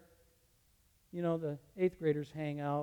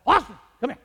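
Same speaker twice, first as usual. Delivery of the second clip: loud sharp call down the hall; 9 semitones higher, 19 dB louder, faster than usual. Retold or imitated. Teacher calling a student back, loud call. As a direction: call the boy back firmly.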